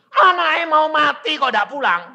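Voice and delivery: high, loud retold reproach; scolding neighbour. Neighbours reproach absent father, high and indignant. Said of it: "Tetangga bilang gini".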